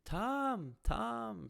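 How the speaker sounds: high, loud mock scream of a name; imitated screaming. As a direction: call it out like protesters yelling for someone. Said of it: "they keep screaming my name".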